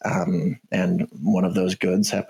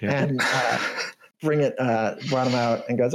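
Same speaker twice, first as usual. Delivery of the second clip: usual speaking voice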